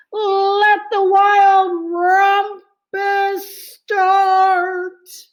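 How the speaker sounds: high, loud, slow sung-out call; excited cry. Acted. Max the king crying out to start the rumpus, excited and sung. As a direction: chant it like a wild cheer. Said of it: "cried Max".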